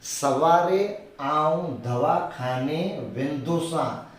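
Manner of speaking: slow, each word laid out; slow Kutchi dictation. Shown as a demonstration. Teacher teaching the main Kutchi version slowly. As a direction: give the line slowly. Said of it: "कच्छी सीख रहे हैं ना".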